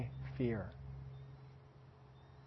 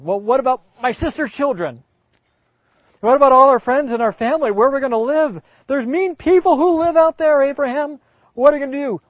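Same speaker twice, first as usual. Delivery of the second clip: rising, much higher and louder frantic questions; panicked Sarah; 7 semitones higher, 7 dB louder than usual. Acted. Sarah panicking at Abraham about leaving, frantic and loud. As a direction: pile up worried objections faster. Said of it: "what she would say".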